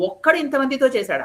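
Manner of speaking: a king who cannot believe one man did this; ఒక్కడు hit high in shocked disbelief; astonished. A narrator's reading. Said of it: "ఆశ్చర్యపోయాడు రావణాసురుడు".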